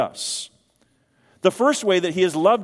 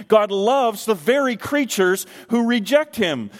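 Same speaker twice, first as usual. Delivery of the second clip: normal talking voice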